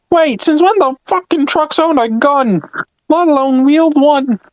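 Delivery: slow, very high incredulous cartoon voice; bewildered engine. Engine questioning an armed truck, high incredulous voice. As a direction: squeal it in slow disbelief. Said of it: "one engine's like".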